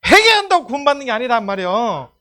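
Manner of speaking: high, urgent sermon delivery; full-force preaching. Real, not acted. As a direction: drive the doctrine home loudly.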